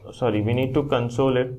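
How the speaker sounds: ordinary delivery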